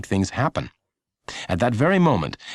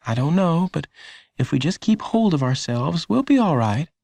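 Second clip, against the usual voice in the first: mother reassuring; 9 semitones higher than usual. A narrator's reading.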